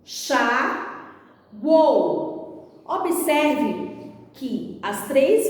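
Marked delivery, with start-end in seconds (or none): 0.0-2.8 em, chá, uou as separate beats with pauses; each of em, chá, uou said alone; syllable by syllable. Shown as a demonstration. Teacher splits word into syllables.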